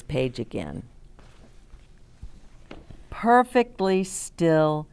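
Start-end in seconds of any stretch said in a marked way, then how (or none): 3.1-4.9 slow reread. Shown as a demonstration. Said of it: "I want you to see this page again".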